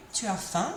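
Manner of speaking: teacher's model question, "faim" going up; rising intonation. Shown as a demonstration. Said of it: "put my intonation up at the end".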